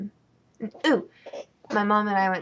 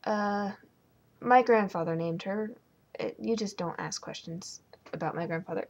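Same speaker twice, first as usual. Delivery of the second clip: ordinary delivery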